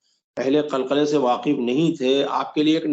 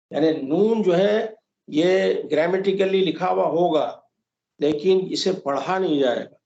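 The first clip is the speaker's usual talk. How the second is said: ordinary talk, nothing marked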